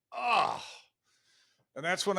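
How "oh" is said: a high, emotional "Oh" near tears; choked-up surprise. Retold or imitated. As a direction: relief catching in the throat. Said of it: "And I'm like".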